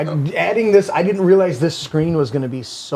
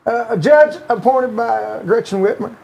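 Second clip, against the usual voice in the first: Bill Clinton voice; 4 semitones higher than usual. Retold or imitated. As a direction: read the article as a mild Clinton impression with a Southern accent. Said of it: "do Bill Clinton's voice".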